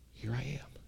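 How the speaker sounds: hushed whisper; whispered reply. Acted. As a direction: breathe it like a humble answer. Jacob answers God quietly.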